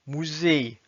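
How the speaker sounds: "Musée" as Russian "muzey"; Russian pronunciation. Shown as a demonstration. Teacher reading words with learners, "Musée" in Russian. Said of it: "s'entraîner à lire les mots".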